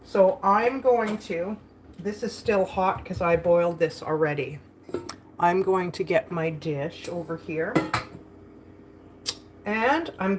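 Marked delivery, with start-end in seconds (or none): none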